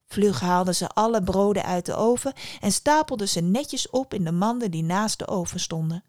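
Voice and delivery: ordinary delivery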